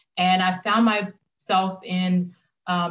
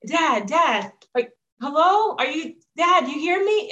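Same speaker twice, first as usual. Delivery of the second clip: high, eager retold phone call to dad, climbing higher at the end; excited calling; 5 semitones higher than usual. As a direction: urge dad to listen, getting higher and more insistent.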